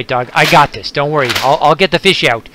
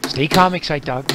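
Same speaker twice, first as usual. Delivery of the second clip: normal talking voice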